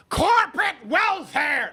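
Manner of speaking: high, slow mock yell of the slogan; hammed-up Bernie shout. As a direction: bellow it like a parody of Bernie. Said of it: "is a term Bernie Sanders".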